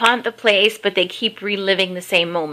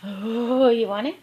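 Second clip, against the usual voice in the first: pet talk; 2 semitones higher, much slower than usual. Real, not acted. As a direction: coo at the puppy over her toy. Talking to the dog with toy.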